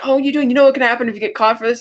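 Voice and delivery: quick panicky mock warning; alarmed. [name] alarmed at hacking.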